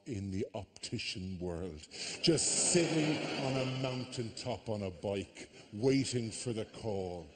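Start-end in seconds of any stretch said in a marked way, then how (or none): none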